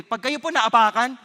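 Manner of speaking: usual speaking voice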